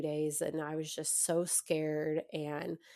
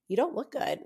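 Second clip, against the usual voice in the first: "you don't look good" echoed much higher; emphatic echo; 7 semitones higher than usual. Retold or imitated. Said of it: "she was just like".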